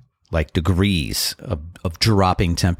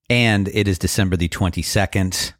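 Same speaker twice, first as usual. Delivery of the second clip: ordinary talk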